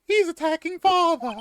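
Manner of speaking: very high, loud, slow British cry; British child. Acted. British boy seeing his father attacked, high alarmed cry. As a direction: wail in a squeaky British boy voice. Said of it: "he's British now".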